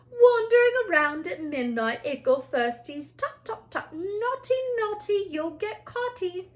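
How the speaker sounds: high, sing-song taunting poltergeist; mocking poltergeist. A narrator's reading. Peeves taunting the students at midnight, high sing-song. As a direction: tease them in a high babyish sing-song.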